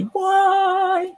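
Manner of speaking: squeaky why. Pretend. Mock high exclaim to object.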